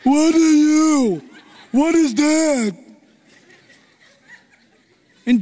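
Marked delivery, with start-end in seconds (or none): 0.0-2.8 slow, laughing sneer of a giant, higher and louder; the giant laughs at the youth, slow and louder; Goliath mocking. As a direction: scoff down at the boy while laughing. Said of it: "and he looks".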